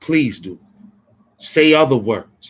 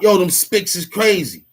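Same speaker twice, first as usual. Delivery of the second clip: higher-pitched mock call-out; half-raised mock shout; mock yell; 7 semitones higher than usual. Shown as a demonstration. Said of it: "We don't yell out".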